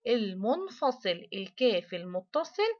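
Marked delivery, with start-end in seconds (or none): 0.1-1.3 المنفصل said slowly with a falling end; drawn-out answer. Shown as a demonstration. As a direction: spell out المنفصل slowly for the class. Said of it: "ده الكاف الإيه؟". (نطق بطيء ممدود لكلمة المنفصل)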